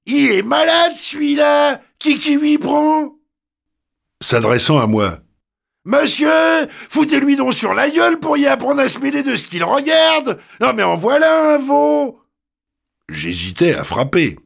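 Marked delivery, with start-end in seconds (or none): 0.1-3.2 put-on hoarse Paris street girl, much higher, shouting; hoarse working-class voice. The girl turns on the young man, hoarse, shouting. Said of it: "d'une voix enrouée et faubourienne". 5.8-12.2 put-on hoarse Paris street girl, much higher, shouting; hoarse working-class voice. The girl turns on the young man, hoarse, shouting. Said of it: "d'une voix enrouée et faubourienne".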